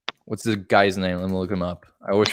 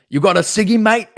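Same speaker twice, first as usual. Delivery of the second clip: higher, cheeky British "You got a ciggy, mate?"; British accent; 9 semitones higher, 4 dB louder than usual. Shown as a demonstration. Showing her friend how the British accent should go, chirpy British. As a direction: toss it off like a London bloke, British. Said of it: "I was expecting".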